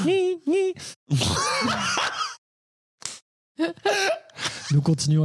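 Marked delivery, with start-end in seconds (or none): 0.1-3.6 squeaky squeal. Pretend. Parody squeal.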